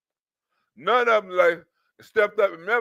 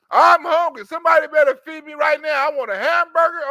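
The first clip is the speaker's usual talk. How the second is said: louder, higher whiny demand for food; mock demanding passenger; 5 semitones higher, 5 dB louder than usual. Mocking the subway man demanding food, higher and louder. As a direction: bellow like a spoiled man demanding a burger.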